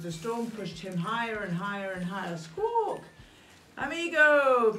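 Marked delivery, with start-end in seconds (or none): 3.8-4.8 loud high call. Acted. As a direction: hail a friend. Kite calls out.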